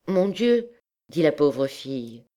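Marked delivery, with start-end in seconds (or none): 0.1-0.7 put-on higher, lightly girlish dismay; hurt young girl. A narrator's reading. Poor girl hurt about her name, higher and lightly dismayed. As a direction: sigh it as a wounded girl would.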